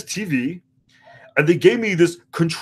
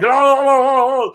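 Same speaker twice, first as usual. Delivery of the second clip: silly singsong; 6 semitones higher, 5 dB louder than usual. Retold or imitated. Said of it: "I was like".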